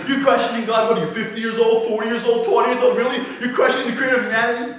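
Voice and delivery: high, loud mock-prosecutor challenge; incredulous accusation. Accuser looking down at congregants questioning God, loudly incredulous.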